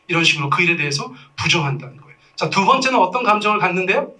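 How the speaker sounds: ordinary talk, nothing marked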